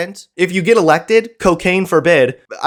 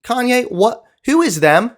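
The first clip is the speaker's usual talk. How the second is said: mock polite question; 7 semitones higher than usual. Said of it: "a simple question is asked".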